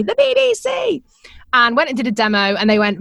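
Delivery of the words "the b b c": can't believe the BBC is calling; amazed disbelief. Retold or imitated. Young presenter marvelling that the BBC rang her, high amazed squeal.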